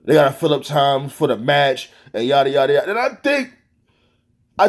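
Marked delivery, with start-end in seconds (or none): 0.0-2.9 low mocking imitation of the excuse-makers. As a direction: brush off the excuse in a dismissive low voice. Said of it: "I don't wanna hear".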